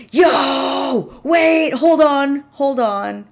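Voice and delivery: loud, high-pitched yells of amazement; excited shouting. Real, not acted. Streamer finding old dress up site still up, excited shouting. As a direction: burst out in real excitement.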